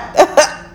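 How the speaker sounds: airy high giggle; a breathy burst of laughter; laughing at her own finality. Real, not acted. Laughs mid-declaration.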